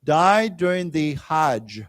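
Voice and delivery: slow, measured reading for a class; read-along. Shown as a demonstration. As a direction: read slowly in phrases so learners can follow. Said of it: "let's say it again".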